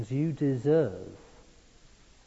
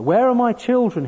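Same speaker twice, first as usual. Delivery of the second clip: very high, loud desperate plea; pleading cry; 13 semitones higher, 11 dB louder than usual. A narrator's reading. Terrified man pleading in the dark for his children. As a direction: beg with all your voice. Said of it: "he pleaded".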